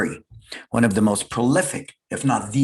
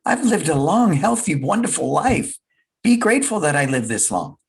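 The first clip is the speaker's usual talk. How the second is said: higher, tender voice of the dying grandmother; grandmother comforting; 6 semitones higher than usual. Retold or imitated. Dying grandmother comforting her crying son, gently. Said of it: "She goes".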